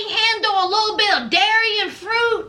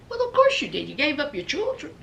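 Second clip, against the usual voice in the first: ordinary talk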